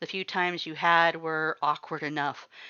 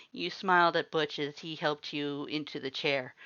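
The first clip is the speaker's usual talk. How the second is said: plain reading voice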